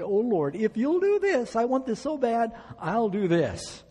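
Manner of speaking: high, pleading, drawn-out begging; desperate pleading bargain. Acted. Preacher acting out his tempted prayer, in a high pleading beg. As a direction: beg God like a child wanting something badly.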